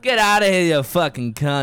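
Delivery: hammed-up angry Aussie; mock Australian yell. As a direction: bawl out an insult in put-on Australian.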